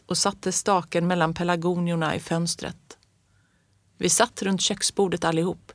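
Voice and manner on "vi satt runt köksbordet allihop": ordinary delivery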